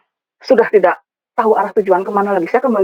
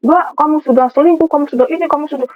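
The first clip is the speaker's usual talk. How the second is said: higher-pitched, rattled-off retold accusation; mock accusation; 6 semitones higher than usual. Accusers charging her with cheating, imitated accusingly. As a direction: imitate someone piling on charges.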